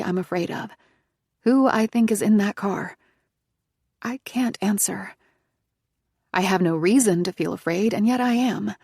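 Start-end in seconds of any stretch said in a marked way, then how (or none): none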